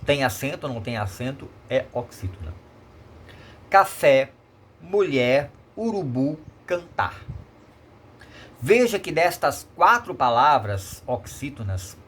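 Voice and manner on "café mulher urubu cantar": "Café, mulher, urubu, cantar" each stressed on its last syllable; final-syllable stress. Shown as a demonstration. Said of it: "Última sílaba, forte".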